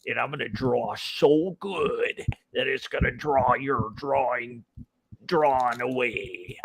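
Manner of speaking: slow, lightly menacing taunt; a hint of wrestler trash talk; wrestler smack talk. Shown as a demonstration. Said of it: "a draw for something where it's like".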